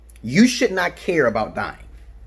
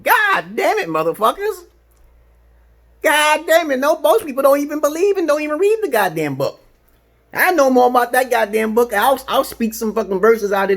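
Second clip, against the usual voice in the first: high, loud exasperated yelling; angry rant; 11 semitones higher, 4 dB louder than usual. Real, not acted. Yelling at religious people who never read the book, angry and high. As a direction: blow up at the hypocrites.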